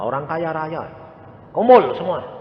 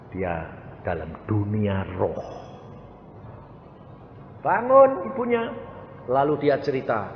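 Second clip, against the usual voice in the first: ordinary talk, nothing marked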